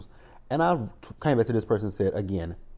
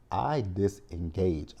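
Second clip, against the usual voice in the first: usual speaking voice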